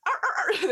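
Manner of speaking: high repeated grunts of effort; desperate straining noises. Acted. Player acting Harriet trying to get in, desperate grunts. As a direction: strain comically at a stuck door. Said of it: "desperately trying to open the door".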